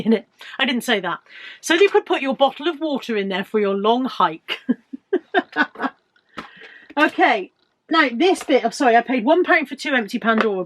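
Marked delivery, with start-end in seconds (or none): 4.6-5.9 a short high giggle; amused giggle. Real, not acted. Laughing at her own rave joke, a short high giggle.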